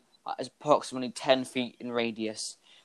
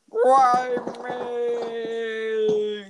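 much higher wailing cry; high falling crew cry; 11 semitones higher than usual. Acted. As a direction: wail it as you fly off. Flying crew member cries.

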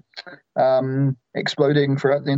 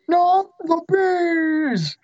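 very high, slow, wailing cry; Nicolas Cage impression; much slower than usual. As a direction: wail it in despair. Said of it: "Nicolas Cage has met".

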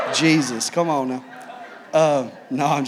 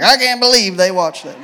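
prudish churchgoer; 3 semitones higher, 7 dB louder than usual. Retold or imitated. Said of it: "too saved is gonna be like".

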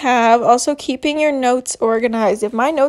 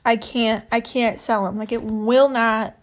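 normal talking voice

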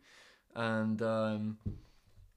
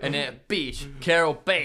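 Joe Exotic; 4 semitones higher, 7 dB louder, slower than usual. Acted. Co-host as tiger keeper cursing a rival, loud impression. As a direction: spit out the rival's name in a put-on voice. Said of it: "me, Joe Exotic".

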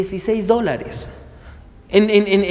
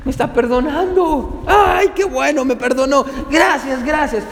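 agudo y gritando de alegría, gracias desbordadas; siervo aliviado y eufórico; 5 semitones higher than usual. Acted. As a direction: como quien recibe el perdón de una deuda imposible. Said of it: "¿se imagina la cara del hombre?". (el siervo perdonado grita de alegría ante el rey)

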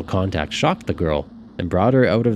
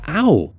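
pained ow; 10 semitones higher, 6 dB louder than usual. Acted. Susie's arm gripped.

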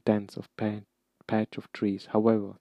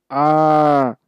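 a sudden loud high scream; terrible scream; 6 semitones higher, 11 dB louder than usual. A narrator's reading. Malfoy screaming in the dark forest, piercing scream. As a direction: let out a piercing cry of terror. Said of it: "terrible scream".

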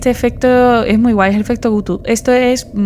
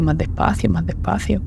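slowing down and fading; 6 dB quieter than usual. Acted. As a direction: voice sinking and fading away. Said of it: "yo te voy a ir viendo, como si cada vez fueras más despacio".

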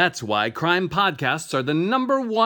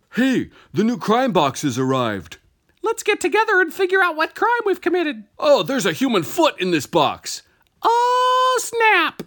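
high-pitched, hammed-up excitement; over-excited ad customer; 13 semitones higher, 4 dB louder than usual. Friends opening their new crime box, over-excited high voice. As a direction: squeal it like a customer in a cheesy commercial.

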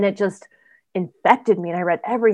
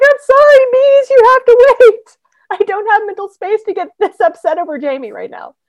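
hammed-up wail to fans about a book she can't read yet; dramatic mock apology; 13 semitones higher, 9 dB louder than usual.

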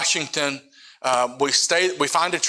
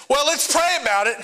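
mocking; 9 semitones higher than usual. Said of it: "He didn't tell me that".

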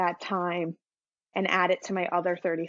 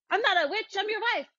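put-on higher, indignant old woman; old witch wife; 11 semitones higher than usual. Old wife retorts to her husband, higher and indignant. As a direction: snap back like an offended old wife. Quoting the old wife's outburst.

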